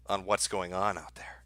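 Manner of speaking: ominous whispered sign-off; spooky whisper. Pretend. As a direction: drop to an eerie whisper.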